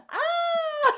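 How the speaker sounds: high, loud squeaky critter cry; tiny possum shriek, played for cute; baby possum cry. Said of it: "that little possum going".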